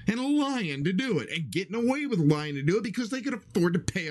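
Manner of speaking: pitched high, heated and insistent; worked-up rant. Real, not acted. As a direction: rail at them like you're fed up. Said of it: "he's raising his voice at me".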